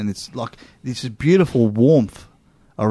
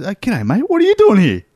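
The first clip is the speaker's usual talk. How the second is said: high-pitched, exclaimed mate-to-mate greeting; retold hearty greeting; 9 semitones higher, 4 dB louder than usual. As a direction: pitch leaps up on the question, louder at the end. Retells greeting the expat shop worker.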